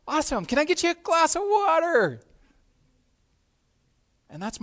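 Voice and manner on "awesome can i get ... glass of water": much higher, louder, overbright hostess voice; perky hostess. Retold or imitated. Hostess covering an empty fridge, overbright high voice. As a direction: sell the glass of water like a treat. Host offering water brightly.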